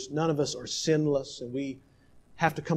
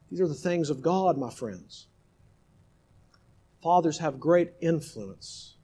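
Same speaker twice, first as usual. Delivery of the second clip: ordinary delivery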